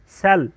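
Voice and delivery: सेल said with an s, "sel"; soft c as s. Shown as a demonstration. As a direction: give सेल a clean s at the start. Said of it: "सेल में सी के बाद ई आया है".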